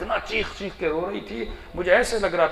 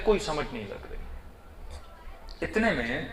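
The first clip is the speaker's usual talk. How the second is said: usual speaking voice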